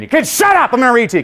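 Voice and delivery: high, cranky mock shout; grumpy old man. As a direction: scold the kids as a crabby old man. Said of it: "I bring in grumpy old man".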